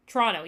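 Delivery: Toronto said as tr-ON-oh; two quick beats, T's dropped; Canadian Toronto. Shown as a demonstration. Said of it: "T R O N O".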